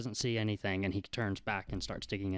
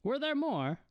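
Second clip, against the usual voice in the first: put-on high-pitched old shopkeeper; high, inquisitive old man; curious old man. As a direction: ask in a high old-fellow voice. Old man asks about the bird.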